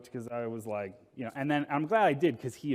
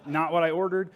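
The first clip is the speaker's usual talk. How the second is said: laughing; 4 semitones higher, 7 dB louder than usual. Retold or imitated. Telling staff about roach, laughing.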